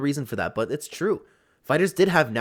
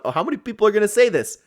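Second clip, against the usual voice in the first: ordinary delivery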